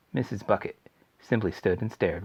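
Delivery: ordinary delivery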